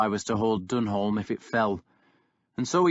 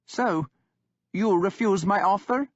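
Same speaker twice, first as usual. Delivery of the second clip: put-on young king holding firm; defiant young king; 9 semitones higher, 3 dB louder than usual. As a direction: stand your ground, unmoved by the insult. Guthred asks.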